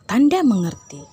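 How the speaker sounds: ordinary talk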